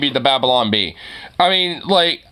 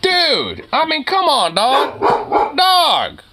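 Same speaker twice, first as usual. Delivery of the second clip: scolding dog; 11 semitones higher, slower than usual. Real, not acted.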